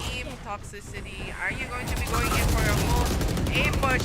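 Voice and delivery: loud, excited play-by-play; esports caster. Acted. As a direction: call the action as it happens. Live play-by-play of a push.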